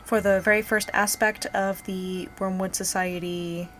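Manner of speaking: low, sultry ASMR voice. Real, not acted. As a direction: speak low and intimate, ASMR style. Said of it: "talking in this, uh, low, slightly sultry voice".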